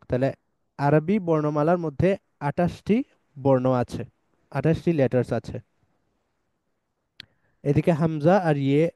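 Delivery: ordinary talk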